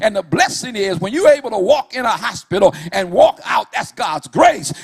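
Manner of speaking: whooping preacher at full force; full-force preaching. Acted. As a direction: preach it hard, riding the music. Preaching climax over music.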